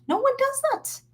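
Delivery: very high, scoffing disbelief; high mocking no one does that; mock high incredulity. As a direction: squeak out the disbelief. Mocking absurd bathing.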